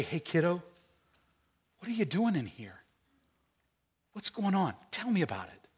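soft, slightly lifted, gentle coaxing; tender father. Acted. Dad sitting at end of daughter's bed, tender. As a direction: gently draw her out, unhurried pauses between phrases. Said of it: "go to the end of her bed".

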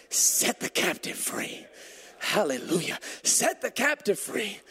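strained, forceful exhortation; fervent preaching. Real, not acted. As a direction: proclaim it with drawn-out intensity.

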